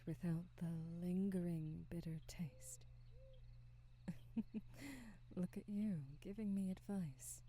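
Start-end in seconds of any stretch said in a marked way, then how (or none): 2.9-5.3 soft amused laugh. Acted. Amused at being given advice.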